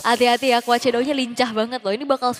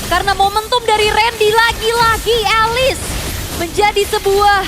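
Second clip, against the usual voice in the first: higher and louder hype caster call; excited play-by-play; 6 semitones higher, 4 dB louder than usual. Real, not acted. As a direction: build the momentum like a caster. Co-caster hyping the swing. (komentator esports yang berapi-api)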